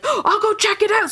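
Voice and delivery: retold eager burst; excited. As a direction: jump at the chance. Spotting a fast moving light.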